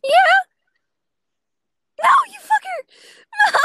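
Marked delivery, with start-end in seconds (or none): 2.0-3.6 shout. Real, not acted. Killer catches her in game.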